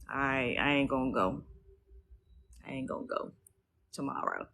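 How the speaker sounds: giving in reluctantly under your breath; retold promise to the team, quietly grudging; grudging mutter. Retold reluctant reply to her team.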